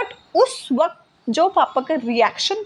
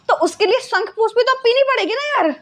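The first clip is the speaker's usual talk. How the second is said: ordinary delivery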